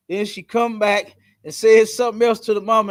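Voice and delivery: ordinary delivery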